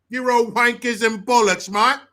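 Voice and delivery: high, loud British lad; mock British accent. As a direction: throw it out in hammy British. Putting on British accent for slang.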